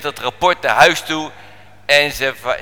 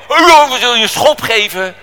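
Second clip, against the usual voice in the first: loud, high mocking threat; mock bullying; 8 semitones higher, 7 dB louder than usual. Big sailors picking on the boy, loud mock threat. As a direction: put on a rowdy bully's threat.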